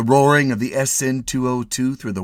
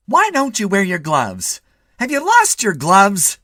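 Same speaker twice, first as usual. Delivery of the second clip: higher, louder slow nagging wife; fussing wife; 13 semitones higher, 9 dB louder than usual. A narrator's reading. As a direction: fret over him like a worried wife. Wife nags.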